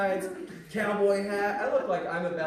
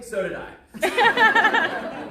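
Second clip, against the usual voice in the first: drawn-out punchline; 13 semitones higher, 8 dB louder, much slower than usual. Acted. Punchline drawn out after pause.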